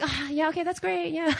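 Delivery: soft, weakly whimpered approval; feigned agreement. Retold or imitated. Wife humoring excited husband, unconvinced. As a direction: agree through a mock tearful laugh.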